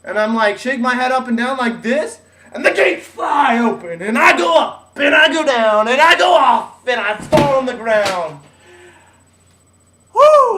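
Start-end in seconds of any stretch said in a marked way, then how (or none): 2.5-8.4 louder and higher, caught up in the ride; breathless thrill of being thrown off; excited retelling. Retold or imitated. Reliving the bull ride. 10.1-10.6 loud, high whoop of triumph; whooping after surviving the ride. Real, not acted.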